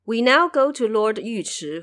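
ordinary delivery

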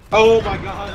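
loud exasperated cry; frustrated outburst. Real, not acted. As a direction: groan at his own miss. Missed shot frustration.

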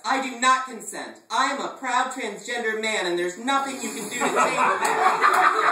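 high, loud defiant proclamation; hammed-up defiant declaration; proud declaration. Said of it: "I puffed out my chest".

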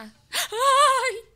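high startled yelp. Real, not acted. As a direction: cry out in sudden fright. Startled in horror game.

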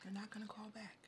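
hushed whisper. Real, not acted. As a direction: whisper it close to her. Hushed reassurance to partner.

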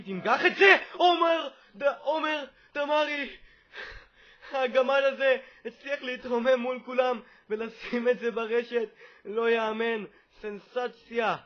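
high, very loud celebration; ecstatic goal shout. Real, not acted. Gamer celebrating Damari's unbelievable header, shouting in excitement. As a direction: yell the scorer's name, then marvel at full volume.

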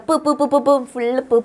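repeated bare പ് sound, lips popping; isolated P sounds. Shown as a demonstration. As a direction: pop out പ്, പ്, പ് like a drill. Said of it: "പിന്റെ കളിയാണ്".

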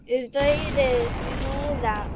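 stretched-out words, half speed; slow dictation. Real, not acted. Player typing "Estoy desnuda" in chat, dictating slowly.

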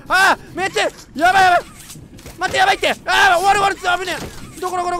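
high, loud frantic cries; panicked game yelling; real panic. Real, not acted. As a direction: yell in terror as it closes in. Chased by monster in game.

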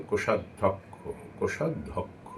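কোষাধ্যক্ষ with a clear অ sound after ধ; correct pronunciation. Shown as a demonstration. Teacher showing students: কোষাধ্যক্ষ pronounced correctly. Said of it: "অ উচ্চারিত হবে".

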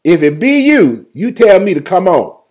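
high, loud bold appeal; bold Peter. Acted. Peter challenges the Lord from the boat, loud and high. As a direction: call up boldly to the Lord. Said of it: "Peter said".

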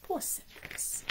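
high, slow pitying whisper. Real, not acted. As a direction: sigh it out in pity. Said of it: "aside in sympathy".

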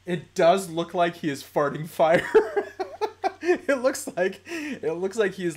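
laughing through the words; cracking up. Real, not acted. Streamer agreeing with the kid's farting-fire remark, cracking up. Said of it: "I started laughing".

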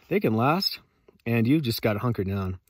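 ordinary talk, nothing marked

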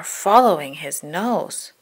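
plain reading voice

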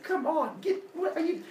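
high, flustered objection; acted high-pitched exasperation; exasperated protest. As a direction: try to break into someone's tirade. Protest amid a yelling match.

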